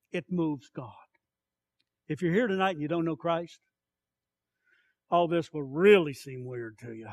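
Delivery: ordinary talk, nothing marked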